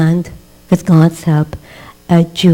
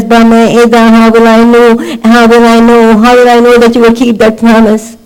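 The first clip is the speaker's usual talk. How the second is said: higher, louder, impassioned preaching plea; pleading Abraham; 6 semitones higher, 6 dB louder than usual. Retold or imitated. Abraham pleading with God over the promise, impassioned. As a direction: beg God for certainty with fervour.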